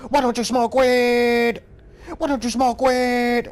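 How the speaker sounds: high, loud, slow goofy boss taunt; mocking a game boss's misheard line. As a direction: mimic a boss attack sound. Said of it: "he would go".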